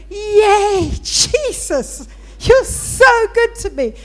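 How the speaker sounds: loud, high, drawn-out cheer of joy; jubilant praise. Retold or imitated. Preacher thanking Jesus for her defrosted car, jubilant. Retelling joyful outburst of praise.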